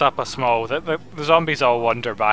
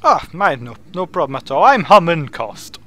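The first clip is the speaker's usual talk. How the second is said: higher, animated wizard introducing himself; courteous wizard; 7 semitones higher, 8 dB louder than usual. Acted. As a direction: play an eager, mannerly wizard host.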